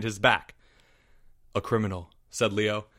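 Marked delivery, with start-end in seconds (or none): 1.5-2.1 low, soft pronouncement; quietly, understated judgement; cold verdict. Acted. Leo judging the sobbing Porge, low quiet verdict.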